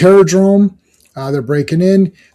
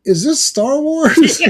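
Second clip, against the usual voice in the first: put-on higher, slow, bewildered kid voice; confused kid voice; 10 semitones higher, slower than usual. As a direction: ask it like a baffled kid, drawn out. Kid in comic reacts.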